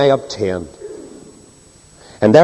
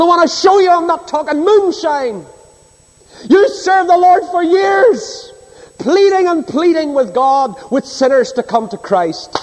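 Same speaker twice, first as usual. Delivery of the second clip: fervent, much higher pleading cry; impassioned preaching. Acted. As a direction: pour your heart out from the pulpit. Preacher testifies with rising fervour.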